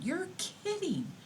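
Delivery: high, astonished question; surprised. Retold or imitated. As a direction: sound amazed at the news. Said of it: "And I'm going".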